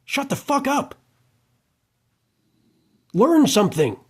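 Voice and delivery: high-pitched, fed-up snap; exasperated outburst. Real, not acted. Ranting at a would-be [name], a high exasperated snap.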